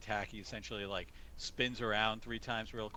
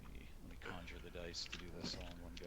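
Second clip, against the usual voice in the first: quick whisper to himself; 3 semitones lower, 6 dB quieter, faster than usual. Real, not acted. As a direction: mutter it under your breath. Whispering to himself while rolling.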